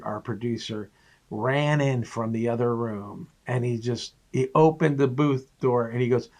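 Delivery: normal talking voice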